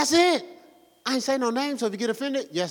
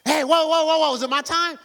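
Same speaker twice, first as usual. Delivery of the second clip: louder, higher startled cry; a hint of a startled demon; panicked unclean spirit; 6 semitones higher, 4 dB louder than usual. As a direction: jump up alarmed. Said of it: "unclean spirit got up and said".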